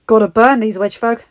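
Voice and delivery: loud, fierce demand. Acted. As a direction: bark it out fiercely. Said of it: "another man said fiercely".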